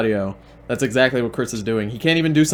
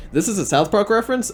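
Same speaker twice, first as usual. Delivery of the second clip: mock disbelief; 7 semitones higher, faster than usual. Mock-incredulous self question.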